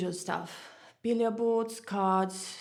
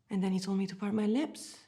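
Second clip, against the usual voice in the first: ordinary delivery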